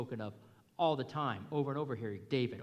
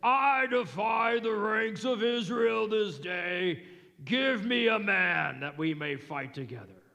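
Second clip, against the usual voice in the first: Philistine's defiance; 6 semitones higher, 6 dB louder than usual. Retold or imitated. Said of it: "And then the Philistine says".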